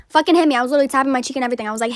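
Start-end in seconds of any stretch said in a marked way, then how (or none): 0.1-0.6 much higher, louder dare; defiant dare. Retold or imitated. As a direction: goad her to throw the punch. Daring the girl to hit.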